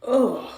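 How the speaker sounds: loud disgusted ugh; exasperated groan. Real, not acted. Disgust at the complaint video.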